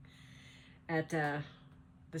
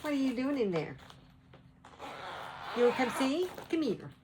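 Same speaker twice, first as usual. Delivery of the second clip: high pet-calling voice; 7 semitones higher than usual. Real, not acted. Vlogger calling her poodle over to see, high coaxing voice. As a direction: call the dog in a high, singsong voice.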